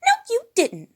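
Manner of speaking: very high, loud snapping denial; high bossy retort; Piggy. A narrator's reading. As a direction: shut him down, high and loud. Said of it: "said Piggy".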